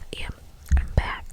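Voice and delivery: ordinary delivery, nothing marked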